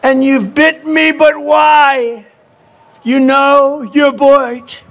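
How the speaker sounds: slow, high, fading plea; mock dying lament; betrayed woman. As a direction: plead with the snake in dying dismay. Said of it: "cried the woman".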